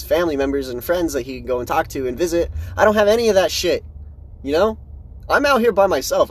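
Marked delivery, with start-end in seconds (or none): 2.8-6.3 raised, louder and faster, venting; frustrated outburst about being alone; worked up. Real, not acted. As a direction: let it burst out higher and louder. Venting about his hardship.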